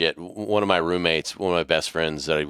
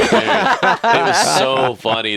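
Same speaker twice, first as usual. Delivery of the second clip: slow, loud laughing; laughing while you say it; 6 semitones higher, 7 dB louder, much slower than usual. Real, not acted. Laughing at the memory.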